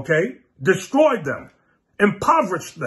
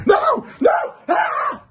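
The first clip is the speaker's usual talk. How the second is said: much higher mock yell, voice cracking into a cry; a hammed-up frantic refusal, pitched way up; wild drugged yell; 3 dB louder, much slower than usual. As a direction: shriek like a man on drugs. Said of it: "Like he was on drugs".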